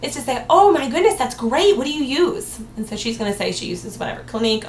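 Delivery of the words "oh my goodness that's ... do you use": eager and upbeat consultant; delighted and confident. Shown as a demonstration. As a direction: sell it like great news, no hesitation. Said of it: "which is the better choice".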